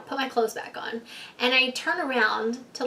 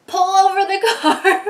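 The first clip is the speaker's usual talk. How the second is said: much louder and higher, laughing through it; laughing demand; 8 semitones higher, 9 dB louder than usual. Retold or imitated. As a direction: yell it half laughing at your friend. Drunk insisting, friend refuses.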